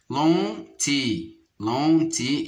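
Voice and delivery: "lentille" split as len, pause, tille; syllable by syllable. Shown as a demonstration. Teacher locating a sound for pupils, "lentille" split apart. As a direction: draw out and separate the syllables of "lentille". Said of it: "dans la deuxième syllabe".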